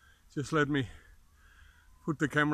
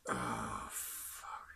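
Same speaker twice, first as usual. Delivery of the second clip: pained whispered curse; 12 dB quieter than usual. Real, not acted. Pain while moving knee.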